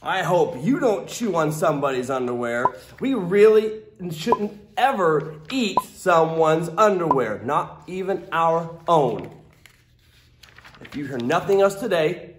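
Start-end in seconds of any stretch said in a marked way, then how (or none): none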